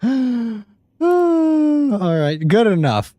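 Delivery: high squealing laugh into good enough. Real, not acted. Streamer laughs off his awful drawing to viewers, laughing. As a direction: give up with a laugh.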